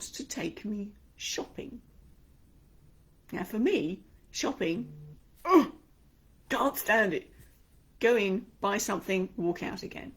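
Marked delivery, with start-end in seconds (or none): 5.4-7.3 disgusted. Real, not acted. Dislike of shopping.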